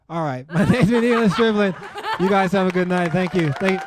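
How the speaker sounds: can't keep a straight face while signing off; laughing sign-off. Real, not acted. Laughing through his own sign-off.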